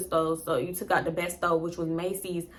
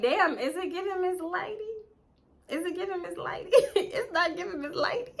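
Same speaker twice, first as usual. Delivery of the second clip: high, softer voice breaking into laughs; laughing incredulously in a raised pitch; amused disbelief; 9 semitones higher, 5 dB quieter than usual. Retold or imitated. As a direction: giggle through a mock question. Laughing at his pet name.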